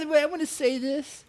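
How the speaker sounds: squeaky high mimicry of a rival; high, taunting copy of what he just said; mocking imitation. Mocking Ethan's line back.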